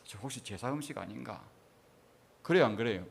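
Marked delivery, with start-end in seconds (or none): 0.0-1.4 hushed, hesitant question; uneasy asking. Acted. Guest wondering about the food served at a holiday visit, hushed and uneasy. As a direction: ask softly, as if a little uneasy. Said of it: "아, 좀 꺼림칙하죠".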